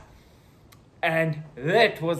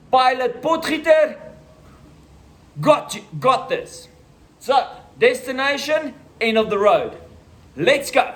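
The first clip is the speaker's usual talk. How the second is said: high, louder hammed-up pilot call; mock pilot bravado; 9 semitones higher, 4 dB louder than usual. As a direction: play the cocky pilot for laughs. Pretends to be a pilot.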